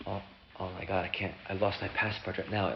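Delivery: low, very soft, halting; subdued dismay. Acted. American namesake admits lost passport, subdued dismay. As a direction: drop to a small, hushed voice.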